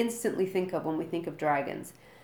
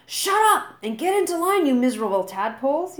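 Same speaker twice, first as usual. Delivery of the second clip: much higher, much louder bellow; yelling; 9 semitones higher, 9 dB louder than usual. A narrator's reading. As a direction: bark at the recruits full force. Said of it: "yelled Gobber the Belch".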